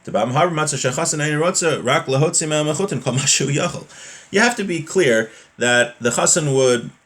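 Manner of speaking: ordinary talk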